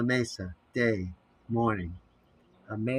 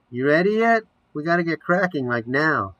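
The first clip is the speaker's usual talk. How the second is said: called loudly and higher from another room; calling out; 6 semitones higher, 6 dB louder than usual. Acted. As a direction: shout through the bathroom door. Bonnie calls from off screen.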